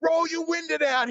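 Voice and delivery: high shout across the lanes; shouted demand. Retold or imitated. Yelling at Brian Christopher to roll his window down. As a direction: yell it at the other car, pitched high. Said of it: "I'm going".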